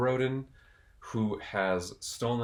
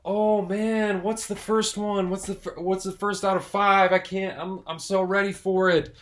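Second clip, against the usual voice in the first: eager viewer imitation; 9 semitones higher, 6 dB louder than usual. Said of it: "I know you're all going".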